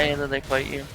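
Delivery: ordinary delivery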